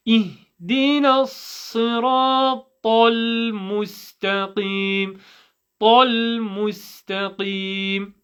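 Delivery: slow, drawn-out measured chant; careful teaching recitation; Quranic recitation. Shown as a demonstration. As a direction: recite it with long held vowels, every letter clear.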